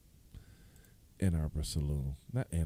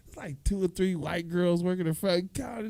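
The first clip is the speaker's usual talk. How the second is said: usual speaking voice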